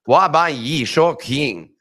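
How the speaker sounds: 滑板语速器 said slowly, syllable by syllable in Mandarin; Chinese pronunciation. Shown as a demonstration. Nick demonstrates the Chinese phrase to viewers. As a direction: read 滑板语速器 slowly and carefully. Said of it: "Nick's pronunciation of a Chinese phrase".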